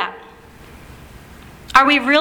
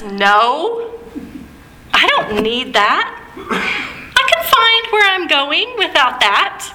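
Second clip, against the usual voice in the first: proud husband brushing it off, lightly voiced; stubborn husband; 3 semitones higher, slower than usual. Husband turning down the rental clerk, lightly voiced and dismissive. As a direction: scoff at the offer like a stubborn husband. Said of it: "His immediate response is".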